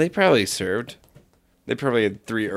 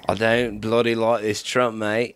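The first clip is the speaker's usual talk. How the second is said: put-on British-Australian accent, slightly lower; British bloke accent; 3 semitones lower than usual. Shown as a demonstration. Correcting a friend's British impression, mock British accent. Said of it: "Shouldn't it be".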